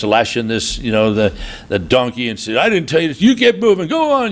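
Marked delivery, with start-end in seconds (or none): 2.6-4.3 mock angry command. Husband lashing the donkey.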